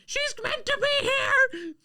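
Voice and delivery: very high, loud, slow hag shriek. Acted. Hag shrieking at the guard: very high, slow, loud hag voice. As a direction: screech it at the guard like an old hag. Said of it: "the hag goes".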